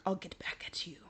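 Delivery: hushed, unvoiced revenge whisper; whispered threat. Brother whispering a threat at his tattling sisters. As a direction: whisper the threat through your teeth. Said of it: "And then Jamie's like".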